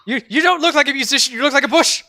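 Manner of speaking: high, loud ranger's accusation; sputtering loud challenge; incredulous shout. Acted. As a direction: yell back in disbelief. Ranger shouts at suspicious bard.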